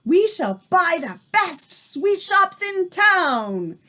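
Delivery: a pack of witches plotting; witch chorus. A narrator's reading. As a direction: savour every word like a greedy coven.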